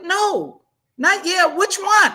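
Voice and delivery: insistent son. Retold or imitated. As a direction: mimic the son demanding an answer. Son pressing mother.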